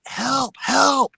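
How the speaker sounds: high, loud call for help; panicked calling out; retold cry for help. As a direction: shout for help, rising. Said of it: "so I'm like".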